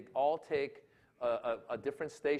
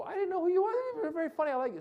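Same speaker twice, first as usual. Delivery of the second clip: much higher voice, praising the comic after the show; surprised new fan; 3 dB louder, much faster than usual. Retold or imitated. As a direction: mimic an audience member in a high-pitched voice. Audience member praising him afterwards.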